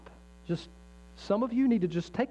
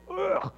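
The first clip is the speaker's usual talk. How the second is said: disgusted ugh; 14 semitones higher than usual. Real, not acted. Disgust at fluffy radio.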